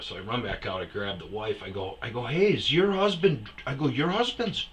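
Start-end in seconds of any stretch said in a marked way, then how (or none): 2.3-4.7 raised, high-pitched urgent voice; agitated confrontation. Retold or imitated. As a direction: confront her about her husband. Confronts the driver's wife.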